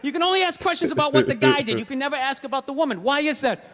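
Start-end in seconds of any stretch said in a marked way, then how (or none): none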